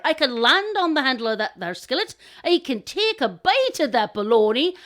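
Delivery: loud, lilting, cocky crow; boastful crow. Acted. Crow bragging of his daring plan, boastful. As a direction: reel off the boast with punch.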